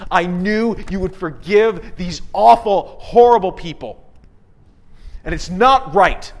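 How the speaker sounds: a prophet angrily telling God it's not right; ticked off. Retold or imitated. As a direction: spit out the outrage at God's mercy. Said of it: "is absolutely ticked".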